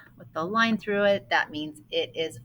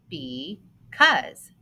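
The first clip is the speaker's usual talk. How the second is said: Because said with both syllables clear, falling; Because, sound out its two parts; syllables shown; 6 semitones higher, 3 dB louder than usual. Shown as a demonstration. Teacher modelling the word's syllables, careful. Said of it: "how many syllables".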